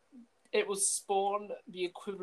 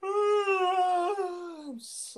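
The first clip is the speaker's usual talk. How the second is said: speaking through a yawn; half a word lost in a yawn; 13 semitones higher than usual. Real, not acted. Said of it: "honestly, I keep yawning".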